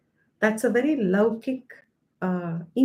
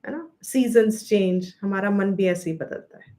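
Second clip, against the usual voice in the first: normal talking voice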